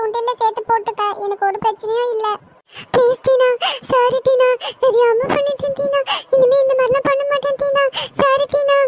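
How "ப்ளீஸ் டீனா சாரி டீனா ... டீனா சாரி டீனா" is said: tearful pleading. Acted. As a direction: beg forgiveness like a crying little sister. Little sister begging, crying. (அழுதுகொண்டே மன்னிப்பு கேட்கும் தங்கை)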